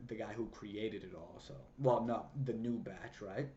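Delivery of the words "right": normal talking voice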